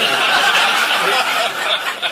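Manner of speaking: breathy burst of laughter at his own joke; loud laugh. Real, not acted. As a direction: laugh it out, real and helpless.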